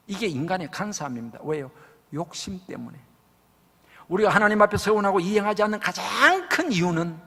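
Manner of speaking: normal talking voice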